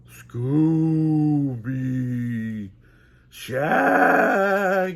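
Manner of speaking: long loud drawn-out ghost howl; loud eerie ghost calling; ghostly call. Acted. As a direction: moan their names from afar. Ghost calls their names.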